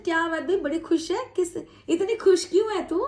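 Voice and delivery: high, curious teasing ask; teasing question. Retold or imitated. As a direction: tease a friend for grinning. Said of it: "जैसे किसी को पूछते हैं ना". (छेड़ते हुए हैरानी भरा सवाल)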